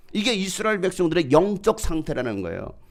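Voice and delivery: ordinary talk, nothing marked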